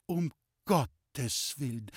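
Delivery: very slow, halting words of shock; faint dismay. A narrator's reading. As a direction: say it weakly, as if about to faint. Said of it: "es ist ihr schwach geworden". (schwach vor Schreck, ganz langsam)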